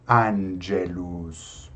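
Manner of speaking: "angelus" with a wide open first A; low, drawn-out "angelus", A kept open. Shown as a demonstration. Teacher repeating the Latin model, "angelus" with open A. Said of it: "deixar o A mais aberto".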